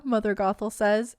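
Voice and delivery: normal talking voice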